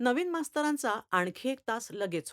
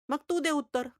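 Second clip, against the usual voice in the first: stern teacher; 7 semitones higher, 4 dB louder than usual. Acted. Teacher presses student in class.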